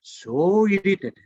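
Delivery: "so irritated" high, loud and drawn out; emphatic stress. Retold or imitated. Storyteller describing the infuriated passenger, "so irritated" stretched out high. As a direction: stretch "so irritated" slowly, pushing it up.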